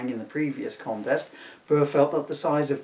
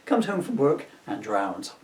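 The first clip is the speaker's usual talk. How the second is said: usual speaking voice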